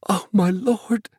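high, slow, awestruck gasp; overjoyed gasp. A narrator's reading. Mother repeating her thanks to Flag, gasping joyfully. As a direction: draw it out high in grateful wonder. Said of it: "she gasped and could think of no more, so she said it again".

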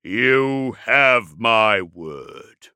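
very slow, loud, weighty pledge; proud. A narrator's reading. Dreadnought gives his enemy his word, proudly. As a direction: declare it grandly, stretching the words. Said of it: "said Magnoric proudly".

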